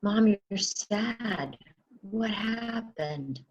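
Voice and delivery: very slow, soft concern; slow tender question to a crying mom. Shown as a demonstration. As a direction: gently ask a tearful mother. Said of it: "you have tears".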